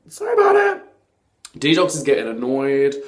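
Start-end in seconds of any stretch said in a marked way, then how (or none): 0.1-0.8 mock apology.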